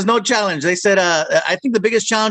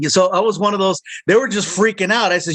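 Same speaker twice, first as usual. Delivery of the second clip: ordinary delivery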